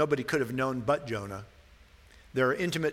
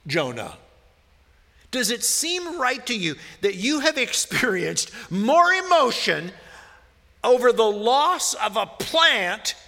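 raised, loud pulpit voice, pressing each question; preaching at full force; 6 semitones higher, 5 dB louder than usual. Acted. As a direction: preach it hard, driving the point home. Pastor preaching on Jonah's anger.

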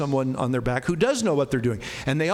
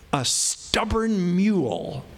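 drawn-out emphasis; much slower than usual. Acted. Stretching out the image.

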